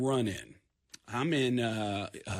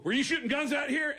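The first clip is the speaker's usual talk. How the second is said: high-pitched, demanding cop question; stern cop; 10 semitones higher than usual. Retold or imitated. Cop getting out of patrol car, stern demand. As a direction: imitate an officer challenging suspects.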